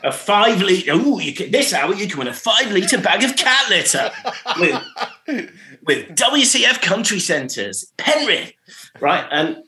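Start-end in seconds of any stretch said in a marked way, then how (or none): 0.1-8.5 presenter voice. Retold or imitated. Mock DJ voice announcing the cat litter prize. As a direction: sell the prize like a cheesy DJ. Doing the on-air prize call.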